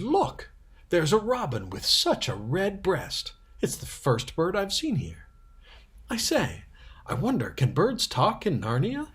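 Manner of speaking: put-on thrilled child pointing out a bird; excited young girl. Lucy spotting the robin.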